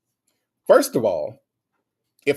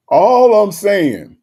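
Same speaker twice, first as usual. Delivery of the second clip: laughing emphasis; 5 semitones higher, 10 dB louder, much slower than usual. Real, not acted.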